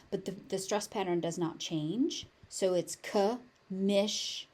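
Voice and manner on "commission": slow "commission", com... miss... ion; syllable by syllable. Shown as a demonstration. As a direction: separate each syllable of "commission". Teacher breaks word into syllables.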